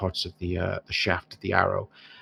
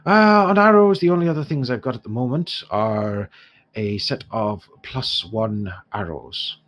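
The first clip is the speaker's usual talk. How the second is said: lightly Irish dwarf trader, lifted openings; haggling dwarf shopkeeper; 6 semitones higher, 6 dB louder than usual. Acted. Dwarf quotes heroes his whole stock, lightly voiced and protesting. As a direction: haggle as a put-upon dwarf.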